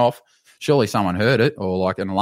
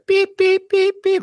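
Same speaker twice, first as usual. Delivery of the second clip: high-pitched alarm beeps; shrill electronic alarm imitation; imitated alarm beep; 4 dB louder, slower than usual. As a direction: beep loudly like an alarm going off. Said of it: "like an alarm goes off".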